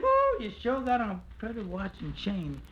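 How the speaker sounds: a woman marvelling at the suitor's coat; woman's whoa. Retold or imitated. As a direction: squeal like a dazzled woman. Woman admires his watch.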